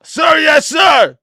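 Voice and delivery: loud barked military reply; drill sergeant. Pretend. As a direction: shout it like a soldier to a sergeant. Mock military reply to order.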